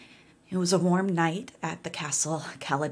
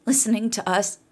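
put-on tired old voice; 4 semitones higher, 4 dB louder than usual. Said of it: "said the old woman".

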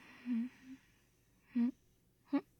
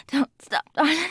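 usual speaking voice

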